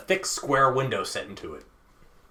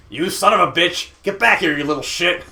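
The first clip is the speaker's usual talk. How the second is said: loud, higher furious villain; enraged shout; 6 semitones higher, 7 dB louder than usual. Acted. Villain screams at boy climbing the rope, enraged shout. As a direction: hurl it after an escaping child. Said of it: "It was like he was an animal".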